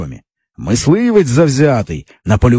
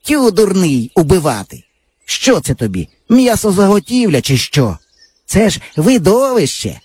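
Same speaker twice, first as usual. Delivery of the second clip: put-on higher eager boy voice; boyish friend; 6 semitones higher than usual. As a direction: brag like a cocky village boy. Java mocks Pavlusha.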